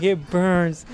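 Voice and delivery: lightly mocked high wail; hammed-up distress; retold panicked girlfriend. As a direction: imitate her pained cry, playing it for laughs. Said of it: "She's like".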